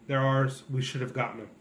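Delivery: ordinary talk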